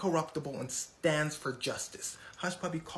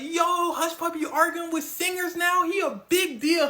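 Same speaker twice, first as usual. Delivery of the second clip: loud, high, hyped crowd voice; hyped mock amazement; 12 semitones higher, 9 dB louder than usual. Fans marvel at Hush Puppy arguing with singers, hyped shouting. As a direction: exclaim it high and loud like an impressed fan. Everyone amazed at his fame.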